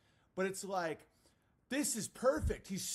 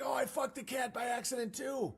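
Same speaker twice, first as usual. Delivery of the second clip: mock confession; 6 semitones higher than usual. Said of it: "he's like".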